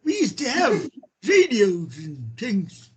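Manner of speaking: lightly voiced creaky old man; a hint of grandpa reminiscing. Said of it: "I do a good old man impression".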